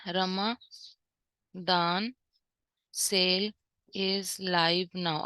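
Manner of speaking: very slow, each word set apart with long pauses; word-by-word dictation. Shown as a demonstration. Teacher typing the banner line for students, dictating word by word. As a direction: dictate it one word at a time as it is typed. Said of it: "यहाँ हम लिख देते हैं".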